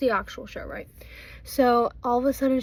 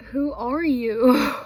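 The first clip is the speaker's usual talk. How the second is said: loud, slow disbelief; incredulous; 7 dB louder, much slower than usual. Retold or imitated. Retelling how the senior spoke to her, loud slow disbelief. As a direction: hit it loud and slow in shock. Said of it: "I was just straight up like".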